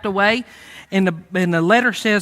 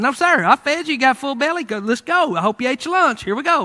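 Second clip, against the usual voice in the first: acted cocky confidence, raised pitch; feeling himself; 7 semitones higher, 3 dB louder than usual. Old prisoner defying his guards, playfully cocky. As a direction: toss it off with swagger. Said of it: "he's feeling himself".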